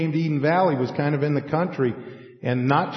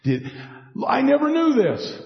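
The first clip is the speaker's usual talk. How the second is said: a laughing breath, then a much higher amazed exclamation; sudden discovery; 11 semitones higher than usual. Retold or imitated. Bible student finding something new, amazed and higher. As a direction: gasp of delight at a new find. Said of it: "we're like".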